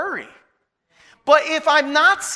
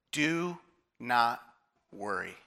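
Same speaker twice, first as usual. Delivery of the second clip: "Do... not... worry", low and soft, paused between words; word-by-word pause; 9 semitones lower, 11 dB quieter, slower than usual. Retold or imitated. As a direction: let each word of "Do not worry" land separately, quietly. Quotes Jesus word by word.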